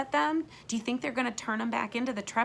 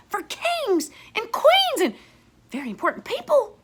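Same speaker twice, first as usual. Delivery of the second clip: put-on awed young boy; 12 semitones higher, 7 dB louder than usual. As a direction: marvel at it like a little kid. Dallas marvels at the table.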